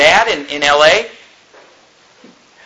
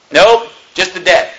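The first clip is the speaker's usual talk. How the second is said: ordinary talk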